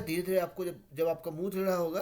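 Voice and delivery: ordinary talk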